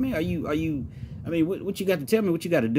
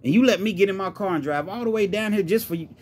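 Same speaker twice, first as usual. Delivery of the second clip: incredulous rant, building higher; angry and hurt; 7 semitones higher, 3 dB louder than usual. Acted. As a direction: vent disbelief at being betrayed. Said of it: "He's angry. He's, he's, he's hurt".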